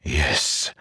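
soft, low whispered yes; quietly agreeing with the sword. A narrator's reading. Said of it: "he whispered to Nightblood".

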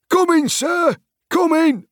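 very high, loud eager call; servant calling back. A narrator's reading. Hobbit servant answering his master's summons, high hurried call. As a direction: pipe up from another room.

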